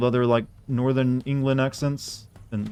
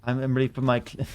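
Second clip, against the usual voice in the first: a weak stab at a Californian drawl; lightly voiced Californian; imitating a Brit doing Californian; Californian accent. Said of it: "even I can't do those accents".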